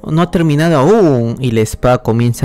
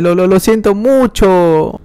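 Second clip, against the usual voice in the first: slow, high, stumbling sorry; a lightly voiced nervous stammer; stammering apology; 6 semitones higher, slower than usual. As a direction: trip over the words in a nervous apology.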